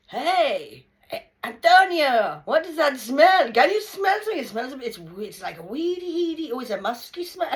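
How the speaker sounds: high, loud teasing call across customs; loud mock sniffing tease. Teasing her nervous brother-in-law at customs, loud and high. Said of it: "So I'm going".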